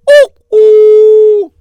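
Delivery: high, loud two-note doorbell ding-dong; imitated doorbell. Kidnappers ring the rabbi's bell, imitated high doorbell chime.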